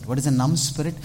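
usual speaking voice

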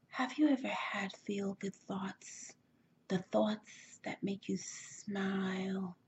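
slow, breathy whisper, drawn out; soft podcast whisper. Shown as a demonstration. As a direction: whisper it slowly, like an intimate podcast voice. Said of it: "that voice where I'm like".

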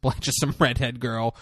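ordinary delivery

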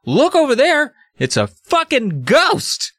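a hammed-up squeaky kid shouting; panicked kid. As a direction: point and yelp like a scared boy. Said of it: "points and says".

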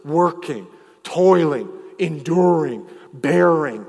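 "working", "toiling", "enduring", "bearing" each set apart and punched; emphasized words. Shown as a demonstration. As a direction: lean hard on "toiling", "enduring" and "bearing", one at a time. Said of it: "I emphasize the words".

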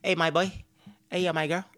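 normal talking voice

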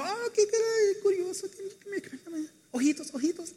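put-on very high cooing baby voice; baby talk. Parent calling cute little kids over, high gushing baby talk.

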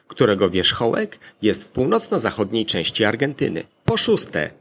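usual speaking voice

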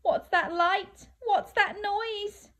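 high-pitched nervous questions; terrified. Acted. Last little monster alone, high acted fright. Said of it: "feeling terrified".

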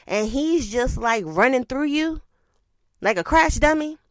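ordinary talk, nothing marked

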